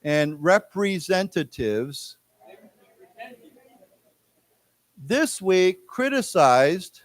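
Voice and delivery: slow, syllable by syllable, with long pauses; slow, clear teacher reading, phrase by phrase; slow read-along. Shown as a demonstration. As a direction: read it out slowly for the class to repeat. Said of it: "let's say it again".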